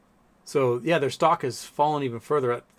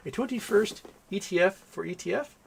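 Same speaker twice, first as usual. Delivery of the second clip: higher, rising puzzled question; baffled repeat; 6 semitones higher than usual. Real, not acted. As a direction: repeat it back in disbelief. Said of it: "The fuck?".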